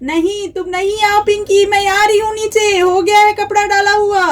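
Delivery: calling down. Acted. Mother calls down from roof.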